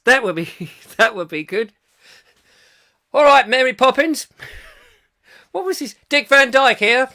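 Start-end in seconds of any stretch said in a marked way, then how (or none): 3.1-4.3 high, loud mock caller voice; comically loud, high-pitched funny old bloke; Kevin Hall. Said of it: "Kevin Hall in Mary Poppins". 6.1-7.1 high, loud mock caller voice; comically loud, high-pitched funny old bloke; Kevin Hall. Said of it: "with a dodgy English accent".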